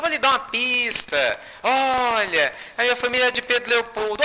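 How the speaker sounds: high-voiced playful clue; playful hinting. Spirit giving Chico a hint, playful hinting. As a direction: tease him with a clue, pitched high. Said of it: "E aí eles brincam".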